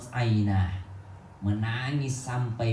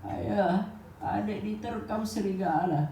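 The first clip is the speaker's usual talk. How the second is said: sons' lying excuse; 6 semitones higher, 4 dB quieter, slower than usual. Acted. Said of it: "Kata mereka".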